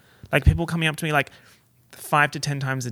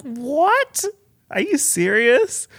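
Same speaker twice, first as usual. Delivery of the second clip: high, loud astonished questioning; stunned disbelief; 13 semitones higher, 6 dB louder, much slower than usual. Retold or imitated. Reliving hearing the cast watched her videos, stunned. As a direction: squeal it in slow-building disbelief.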